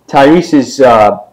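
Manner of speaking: ordinary delivery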